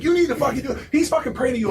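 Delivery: high, loud imitated teammate; high-pitched urgent mock yell; frantic yelling back. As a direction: act out a panicky teammate's shout.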